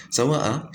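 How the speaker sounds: Sawa'a with a long stretched final vowel; drawn-out mad. Shown as a demonstration. Teacher showing students the long mad in Sawa'a. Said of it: "we said sawa'a".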